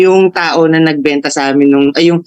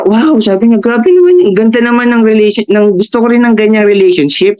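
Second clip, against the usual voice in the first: amazed reaction; 4 semitones higher, 3 dB louder than usual. Retold or imitated. Teacher amazed by her story.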